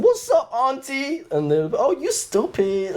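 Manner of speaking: very high, drawled comic American voice; American movie accent. Retold or imitated. Showing his American accent bit to a friend, high and drawled. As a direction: ham it up like Friday. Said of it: "So I always do that accent".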